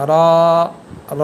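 র as a long, full-mouthed "raw"; tongue tip to palate, heavy held র; thick letter sound. Shown as a demonstration. Teacher showing the thick letter, long rounded র. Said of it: "একটু মোটা হবে".

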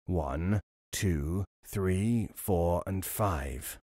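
low, soft count, slowing at the end; low drawn-out count; robber counting. A narrator's reading. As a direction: count again under his breath. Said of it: "the robber beginning again".

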